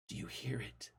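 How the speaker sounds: very soft, hissing whisper; hissed whisper. Acted. Husband pulling wife to floor, hissed whisper. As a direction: hiss it close, secretive and scared. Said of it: "and hissed".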